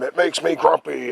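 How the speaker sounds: loud, high grumpy giant voice. Retold or imitated. Giant complaining to the son about daytime, loud and grumpy. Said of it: "And the giant says".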